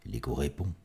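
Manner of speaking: hushed, dropped echo line; fading echo. Acted. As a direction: let it come back as a distant echo. Echo repeats, fading away.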